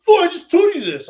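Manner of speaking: high, loud mock exasperation; frustrated voice. Imitating a partner who says they just told you, frustrated voice. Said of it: "this really fucking frustrated voice".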